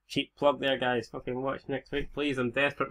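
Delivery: ordinary talk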